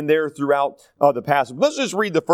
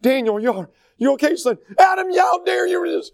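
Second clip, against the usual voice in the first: much higher and louder, panicked father scolding; frantic excited dad ending in a shout; 12 semitones higher, 3 dB louder than usual. Retold or imitated. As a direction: flip from fussing to shouting how dare you. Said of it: "he's kind of an excitable person".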